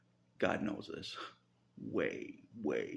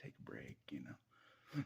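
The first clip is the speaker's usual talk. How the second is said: whispered, softer devil's temptation; tempting devil whisper; 7 semitones higher, 9 dB quieter than usual. Pretend. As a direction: breathe the temptation into his ear. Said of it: "I feel like I'm doing that in my mind".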